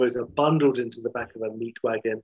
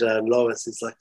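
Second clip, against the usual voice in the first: plain reading voice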